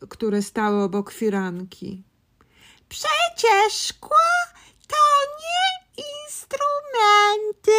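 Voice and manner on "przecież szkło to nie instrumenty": high, slow, upset voice; upset badger. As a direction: plead like a worried badger. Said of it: "powiedział borsuk, bardzo przejęty".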